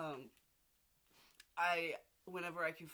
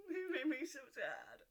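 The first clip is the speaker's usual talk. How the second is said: tearful; 6 semitones higher than usual. Real, not acted. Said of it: "cry heard".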